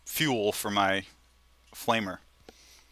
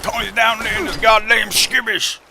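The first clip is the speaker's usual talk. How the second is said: put-on high toothless old southerner holler; screaming southern boss; 11 semitones higher, 4 dB louder than usual. Streamer replays the old boss's radio rant, mock scream. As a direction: garble it in a mock southern yell. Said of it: "he starts screaming into the radio".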